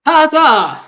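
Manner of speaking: loud, high cheer. Real, not acted. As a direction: cheer the hit loudly. Cheering a hit on owlbear.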